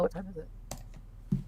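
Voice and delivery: ordinary talk, nothing marked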